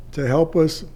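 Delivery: usual speaking voice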